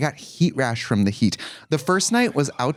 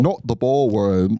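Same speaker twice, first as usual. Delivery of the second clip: slow, drawn-out mock Australian accent; Australian accent attempt; 2 semitones lower, slower than usual. Comic quoting Nicole after Australia trip, in mock Australian accent. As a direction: stretch it out in a dubious Australian accent. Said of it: "I Men in Blacked my accent".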